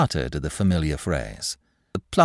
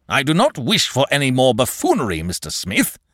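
irate interruption; 7 semitones higher, 4 dB louder than usual. A narrator's reading. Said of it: "Mister Bickersdyke interrupted".

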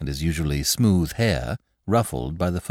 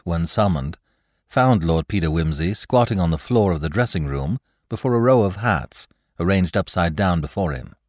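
ordinary delivery, nothing marked

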